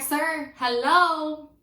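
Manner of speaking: yelling. Retold or imitated. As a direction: shout to get his attention. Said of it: "Over here screaming".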